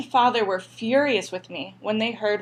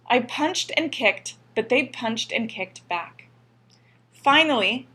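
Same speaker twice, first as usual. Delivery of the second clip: ordinary delivery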